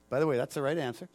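usual speaking voice

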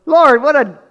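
high, loud scoff; Peter scoffing. Acted. Peter mocking Jesus's question, loud and high. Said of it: "even Peter goes".

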